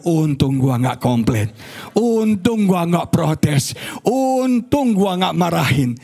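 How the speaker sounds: slow, drawn-out retold relief; relieved inner voice. Preacher recalls his thought after near outburst, drawn-out relief. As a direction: stretch each "untung" as a private thought. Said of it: "Terus dalam hati saya".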